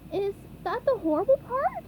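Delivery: high, nervous question; nervous little voice. A narrator's reading. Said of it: "asked the nervous SpongeBob".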